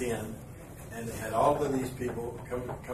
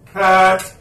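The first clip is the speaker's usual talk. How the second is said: much louder, higher shout; shouted cut; 6 semitones higher, 17 dB louder than usual. Retold or imitated. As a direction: call it across the set. Director calling cut.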